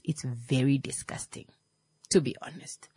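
hushed, breathy whisper; whispered aside. Real, not acted. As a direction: drop to a whisper. Disgusted aside.

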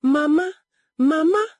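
loud, high, rising calls; crying out. A narrator's reading. Upset daughter running to her mother, crying out. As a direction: cry out for mother, twice over. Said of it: "she cried".

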